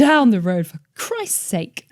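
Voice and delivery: imitating a scolding mother; mock exasperated mum. Mum correcting her slang, exasperated. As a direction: huff it like an annoyed mum. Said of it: "she'd be like".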